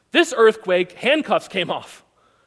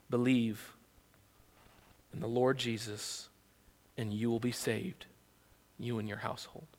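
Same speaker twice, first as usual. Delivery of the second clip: hushed; 7 semitones lower, 12 dB quieter than usual. Acted. Apostles' answer, hushed.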